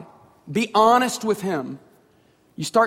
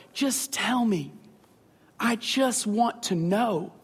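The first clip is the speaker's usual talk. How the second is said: soft, slow loving plea; tender heavenly father; 4 dB quieter, slower than usual. Retold or imitated. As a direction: gently coax a child to open up. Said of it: "our Father in heaven just look at us and go".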